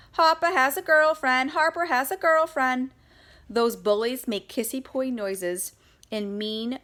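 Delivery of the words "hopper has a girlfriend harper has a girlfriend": high, loud singsong teasing chant; taunting. Acted. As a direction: jeer it like a playground bully.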